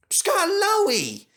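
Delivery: high, loud surprised cry of a name; surprised exclamation. Acted. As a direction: call out in glad surprise. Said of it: "he exclaimed".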